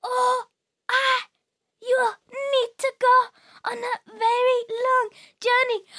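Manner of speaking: put-on high, shaky old robot. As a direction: creak it out like an ancient robot. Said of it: "another very old robot".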